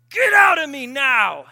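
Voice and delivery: slow, very loud, high command; furious shout. Retold or imitated. Sister ordering the demon out of her, loud slow shout. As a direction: roar it out to drive the demon away.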